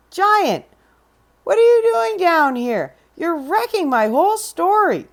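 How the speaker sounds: high, startled scolding; startled and annoyed; surprised Jack. Acted. Jack finding the giant on his page, startled.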